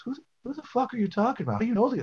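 high mock incredulous yelp; friend's retort. Retelling his buddy's shocked reply, high and mocking. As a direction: throw it back high and disbelieving.

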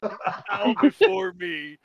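high, very slow hammy command; drawn-out mock-ominous proclamation; mock dark lord. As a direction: play a vampire larper demanding worship. Mock vampire larper commanding others.

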